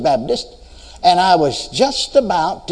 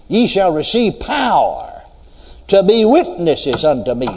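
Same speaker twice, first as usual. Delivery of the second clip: ordinary talk, nothing marked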